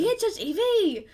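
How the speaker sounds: high, drawn-out bad Scottish accent, laughing; attempted Scottish accent. Shown as a demonstration. Co-host reading listener's letter on a dare, giggly Scottish accent. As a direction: put on a loud, high Scottish voice. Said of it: "do this in a Scottish accent".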